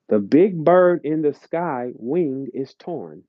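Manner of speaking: ordinary talk, nothing marked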